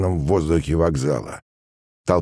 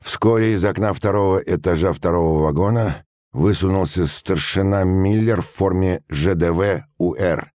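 ordinary delivery

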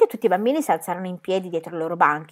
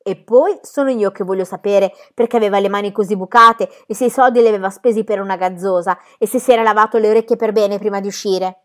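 put-on lively, insistent child firing questions; mildly voiced child, emphatic and quick; cheeky child character; 2 semitones higher, 8 dB louder than usual. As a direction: push on briskly like a cheeky kid.

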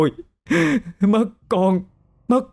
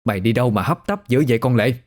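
usual speaking voice

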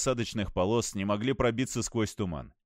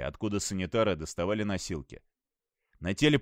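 usual speaking voice

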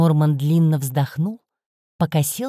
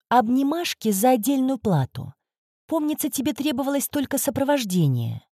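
offhand; 6 semitones higher than usual. A narrator's reading. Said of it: "небрежно бросив через плечо".

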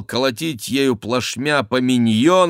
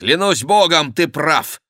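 high, loud burst of agreement; fervent exclamation; 7 semitones higher, 5 dB louder than usual. A narrator's reading. Henri seizes on Maugiron's guess, fervent exclamation. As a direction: exclaim it with sudden conviction. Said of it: "воскликнул Генрих".

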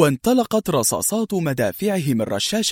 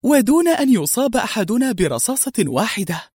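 much higher, impressed voice; admiring exclamation; 7 semitones higher than usual. A narrator's reading. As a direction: exclaim his success with admiration, pitch raised. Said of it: "هتفت منى وهي ترمقه بإعجاب". (هتاف إعجاب بنبرة مرتفعة)

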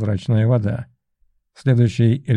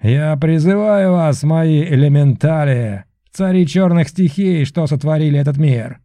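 shouted summoning; 4 semitones higher, 3 dB louder than usual. A narrator's reading. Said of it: "закричал".

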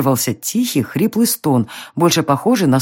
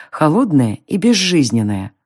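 plain reading voice

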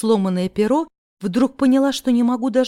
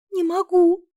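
high, drawn-out whine; worn-out, high-voiced complaint; whining; 9 semitones higher, slower than usual. A narrator's reading. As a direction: whine it helplessly, pitched way up. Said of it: "проныла я".

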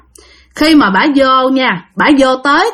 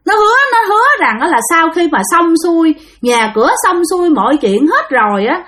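bà già mắng; 7 semitones higher than usual. Retold or imitated. Hồn bà già mắng cậu Sáu.

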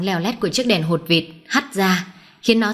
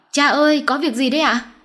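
higher-pitched worried call; anxious calling; 7 semitones higher than usual. A narrator's reading. Son runs to his father asking what happened, anxious call.

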